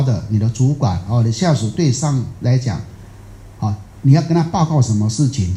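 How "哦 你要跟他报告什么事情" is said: ordinary delivery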